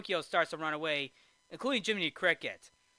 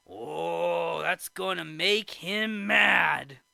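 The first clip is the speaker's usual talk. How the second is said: slow, drawn-out mock warning; hammed-up foreboding, stretched out; Geppetto; 5 dB louder, much slower than usual. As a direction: build to a loud last word. Geppetto warns, acted.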